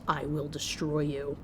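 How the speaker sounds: low, slow, quiet menace; growled threat. A narrator's reading. Boy pins her to the wall, growled threat. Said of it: "and growls".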